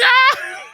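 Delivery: very high loud shriek; panicked dog yelp. Retold or imitated. Dog panicking at the window, very high shriek. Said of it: "my impressions of Reagan and Matilda".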